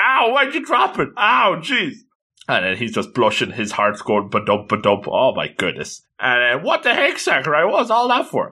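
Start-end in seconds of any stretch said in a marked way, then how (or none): 0.0-2.0 put-on high, whiny ow of a girl's voice; girl's complaint. Girl complaining when Sakurai drops her ankle, high and whiny. 6.6-8.5 high, squeaky indignant protest; a girl demanding an explanation, voice pitched up. Retold or imitated. Toyoguchi scolding Sakurai for dropping her, high indignant squeak.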